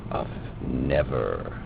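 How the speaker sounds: usual speaking voice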